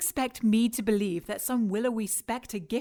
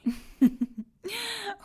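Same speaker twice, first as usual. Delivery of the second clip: amused laugh; 11 semitones higher than usual. Acted. Villain laughs at his flirting.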